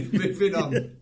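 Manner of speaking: high, loud laughing move-on. Real, not acted. Co-host cracking up at the cocktail story, laughing. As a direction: push on through the giggles, slowly.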